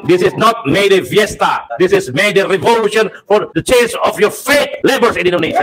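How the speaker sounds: rising rally shout in English; fiery revolutionary speech. Real, not acted. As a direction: fire up the workers, high and ringing.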